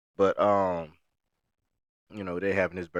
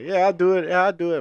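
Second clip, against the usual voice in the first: eager yes; 8 semitones higher, 6 dB louder than usual. Retold or imitated. Eager yes to extra work.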